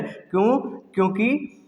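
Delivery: ordinary talk, nothing marked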